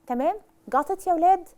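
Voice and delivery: ordinary talk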